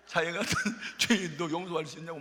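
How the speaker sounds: 같은 said through a chuckle, light and amused; amused retelling. Retold or imitated. Pastor repeating her plea to the congregation with a laugh. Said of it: "무슨 찬송가 가사 같아".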